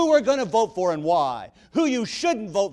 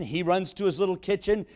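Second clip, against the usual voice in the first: ordinary talk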